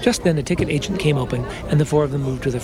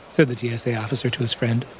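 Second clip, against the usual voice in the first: plain reading voice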